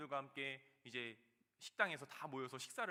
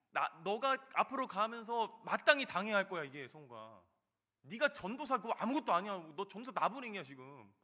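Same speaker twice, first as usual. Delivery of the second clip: loud, higher-pitched dressing-down; forceful rebuke; 4 semitones higher, 6 dB louder than usual. Retold or imitated. The Lord rebuking a proud young evangelist, forceful voiced reprimand. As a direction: put the young upstart in his place.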